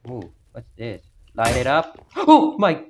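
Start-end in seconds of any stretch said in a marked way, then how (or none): 2.1-2.8 sudden high startled gasp. Real, not acted. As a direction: caught off guard by a scare, gasping. Gasp at jump scare.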